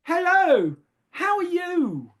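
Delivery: high, slow, lilting greeting to a devotee; imitating Mother's greeting. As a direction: lift the voice way up and welcome him. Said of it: "and she said".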